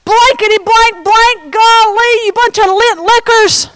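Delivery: high, hammed-up censored swearing; mock cursing. Peter cursing at the bystanders, hammed-up and high. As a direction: rant the stand-in swear words in a high voice. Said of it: "Then he began to curse".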